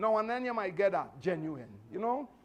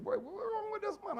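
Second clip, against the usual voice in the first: incredulous exclamation. Retold or imitated. Driver confronts him at car door.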